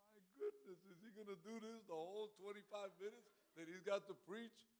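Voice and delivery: high-pitched, incredulous complaint; mock disbelief. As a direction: act out exasperated amazement. Acting out his incredulous reaction.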